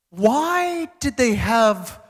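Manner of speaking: slow, high imitated child voice; puzzled kid. Comedian's kid asking dad about Frankfurt, puzzled child voice. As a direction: draw it out high like a wondering kid. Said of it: "when my kids talk about it".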